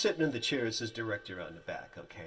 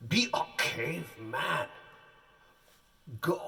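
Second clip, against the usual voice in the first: dramatic director; 4 semitones higher than usual. Retold or imitated. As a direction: send him off with a grand push. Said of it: "He goes".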